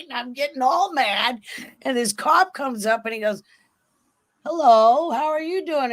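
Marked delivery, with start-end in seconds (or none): 4.4-5.9 acted-out greeting, pitched up and loud; voiced cop greeting. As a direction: imitate a cop hailing a stranded driver. Voices cop greeting stranded driver.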